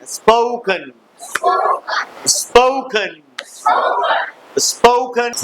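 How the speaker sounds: स्पोकन drawn out as spoh-kun, weak last vowel; model pronunciation. Shown as a demonstration. Teacher leading pupils in repetition, स्पोकन carefully pronounced spoh-kun. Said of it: "स्पोकन कहते हैं".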